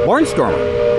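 usual speaking voice

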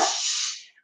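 unvoiced shushing hiss; a quick hissed shh; hush. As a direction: hush her sharply so nobody hears. [name] hushing her again.

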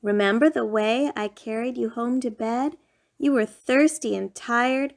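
ordinary delivery, nothing marked

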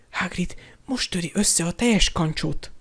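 unvoiced stage whisper; Hermione. A narrator's reading. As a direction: whisper it under your breath. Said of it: "suttogta Hermione". (halk suttogás)

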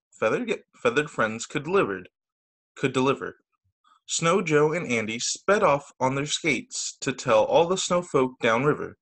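plain reading voice

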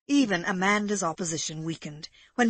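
ordinary delivery

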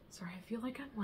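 quick apologetic whisper; whispered aside. Real, not acted.

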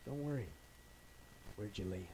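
very soft, hushed comfort; calm the mourner in a near murmur; quiet, tender voice of the Lord; gentle reassurance. Acted.

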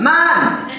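high, swooping Jamaican accent; one hammed-up Jamaican word, pitched high. Shown as a demonstration. Comic showing how sentences end, Jamaican accent. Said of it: "end every sentence in".

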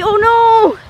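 dismayed cry. Real, not acted. Low battery alarm.

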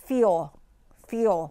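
"feel" as "fyo", curling into a slight w at the end; w-glide ending. Shown as a demonstration. Teacher repeating the tricky word for learners, "feel" as "fyo" with a w-glide. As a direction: say "feel" like "fyo", falling, each one apart. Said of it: "เสียงมีวอแหวนตลบมานิดนึง".

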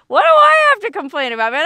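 what could I possibly complain about; incredulous exclaim. Real, not acted. Laughing at her own luck.

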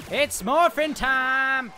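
slow drawn-out battle cry; shouted catchphrase. Real, not acted. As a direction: bellow it like a hero.

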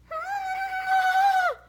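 high, long exasperated sigh; big sigh. Retold or imitated. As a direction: let it all out in one weary groan. Overwhelmed by relentless kids.

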